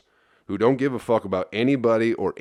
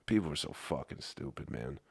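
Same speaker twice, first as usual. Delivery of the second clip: muttered aside; 7 semitones lower, 15 dB quieter than usual. Real, not acted.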